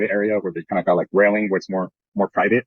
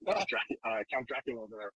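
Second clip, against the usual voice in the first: hushed jab; 2 semitones higher, 14 dB quieter than usual. Retold or imitated. Quietly insulting the old woman.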